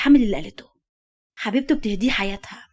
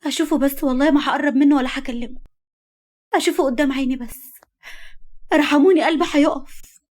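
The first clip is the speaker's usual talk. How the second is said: high, anguished begging; desperate pleading; 5 semitones higher than usual. Acted. Woman begging to see her beloved, desperately pleading. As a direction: beg for mercy, higher than usual. Heroine begs to see him.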